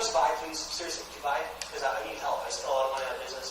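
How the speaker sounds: low, soft, needy sales plea; begging salesman. Acted. New recruit begging friend Trevor to buy, pleading. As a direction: lean on a friend in a hushed low voice. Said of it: "I'm, you know, begging him".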